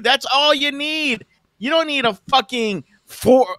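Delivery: high, scornful mock rant. Mocking the other streamer's audience, high scornful rant. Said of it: "I was just fucking with your audience".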